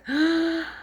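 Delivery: sharp audible inhale; teacher demonstrating a deep breath for children. Shown as a demonstration. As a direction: gasp the air in to show a deep breath. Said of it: "Take a deep breath".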